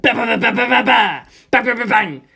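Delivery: loud rapid ba-ba-ba gunfire; machine gun noise. A narrator's reading. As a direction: spit out the gun bursts. Imitating machine gun fire.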